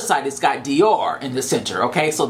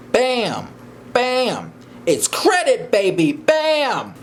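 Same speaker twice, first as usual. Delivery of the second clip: higher, punchy mock swagger; playful bam; 7 semitones higher, much slower than usual. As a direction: snap each bam out like a flourish. Snapping the bag shut, showing off.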